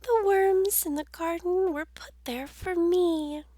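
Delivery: high, airy bird voice; a bird claiming its worms; chirpy bird. Acted. Bird speaking in story.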